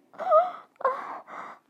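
a sudden shocked gasp of pain and pleasure; sharp high gasp. Acted. Woman gasping loudly as he enters, sharp high cry.

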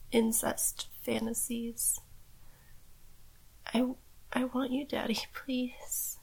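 breathy pleading whisper, barely voiced. Daughter role whispering her plea to Daddy, hushed. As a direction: whisper it close, submissive and pleading. Roleplay submissive whispering to partner.

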